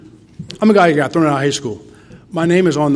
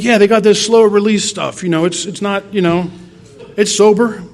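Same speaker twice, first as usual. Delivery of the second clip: high, loud eager pitch; asking his sponsor for Adderall, high and eager; eager pleading; 6 semitones higher, 3 dB louder than usual. Retold or imitated. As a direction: sell it, talking himself up.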